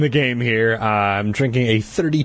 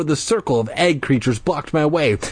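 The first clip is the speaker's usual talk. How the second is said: plain reading voice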